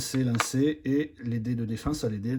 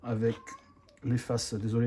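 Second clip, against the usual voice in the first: usual speaking voice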